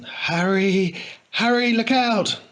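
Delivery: high, loud slow cry; urgent warning. A narrator's reading. Friend warning Harry of the tramp, loud high cry. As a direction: shout a warning to your friend.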